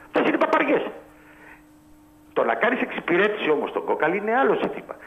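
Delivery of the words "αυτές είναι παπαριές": much higher, pushing hard; angry rant. Real, not acted. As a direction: rail against it, fed up. Caller ranting about referees and Kokalis.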